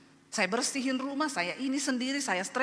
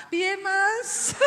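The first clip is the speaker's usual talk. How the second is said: high, put-on wifely voice; imitated dainty wife; sweet Javanese wife; 8 semitones higher, 4 dB louder than usual. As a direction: sweetly prompt the husband in a high lilt.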